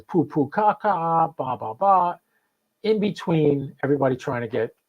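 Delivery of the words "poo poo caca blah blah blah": slow, sing-song childish mockery; lightly voiced radio troll jeering; a hint of a heckler on the air; mocking taunt. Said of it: "He says".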